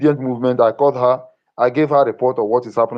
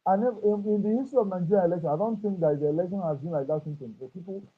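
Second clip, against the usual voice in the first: muffled and much softer; 4 semitones higher, 8 dB quieter than usual. Real, not acted. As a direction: speak as if through a hand over the mouth. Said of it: "your voice is getting muffled".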